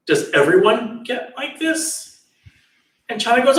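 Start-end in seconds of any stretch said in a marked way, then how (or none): 0.1-2.2 slow, high, rising question; retold dismay, feeling out the question slowly; troubled questioning. Horrified prince asks Channa about old age, troubled.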